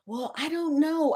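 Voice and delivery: high mocking doctor voice. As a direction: imitate her dithering. Mimicking the unsure doctor.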